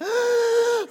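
sharp, very high gasp of surprise; imitated gasp. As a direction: gasp like a child seeing her dream gift. Daughter sees the Barbie Jeep.

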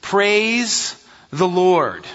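very slow, each word weighted; emphatic praise. Shown as a demonstration. Preacher showing the psalm's emphasis, slow and weighty. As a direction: lean on Praise and draw it out. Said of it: "It's also offered with emphasis".

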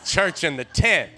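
loud, building church preaching; full-force preaching. Acted. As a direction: shout it to the back pews. Preaching about old-time church.

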